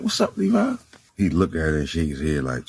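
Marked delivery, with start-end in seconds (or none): 0.0-0.7 high-pitched imitation of a woman; storyteller playing her at the door; voiced woman's greeting. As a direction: pitch it way up for her surprised hello.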